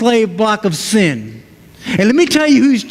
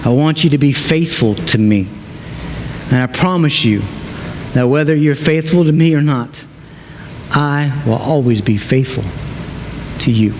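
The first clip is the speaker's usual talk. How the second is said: gentle Jesus promising; 9 semitones lower than usual. Acted. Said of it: "And so Jesus says to us".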